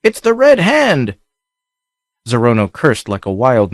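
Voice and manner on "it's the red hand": high, slow call from far off; distant call. A narrator's reading. Lookout calling down the ship's name, slow and high. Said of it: "the reply came back faintly".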